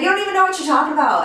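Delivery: fast retort. Retold or imitated. Friends arguing over her boyfriend.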